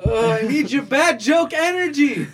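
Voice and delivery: laughing. Real, not acted. Still laughing at joke.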